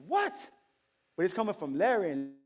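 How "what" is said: sudden high-pitched disbelief; high, incredulous yelp; acted astonishment. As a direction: pop it up high in mock surprise. Acted astonished reply to neighbor's claim.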